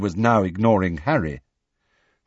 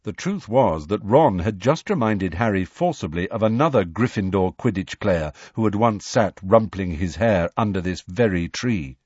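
plain reading voice

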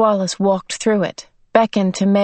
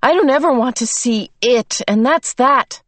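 defiant; 6 semitones higher, 3 dB louder than usual. A narrator's reading. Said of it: "Meg said defiantly".

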